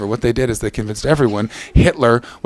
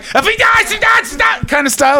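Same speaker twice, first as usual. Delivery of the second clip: menacing; much slower than usual. Shown as a demonstration. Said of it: "like a menacing".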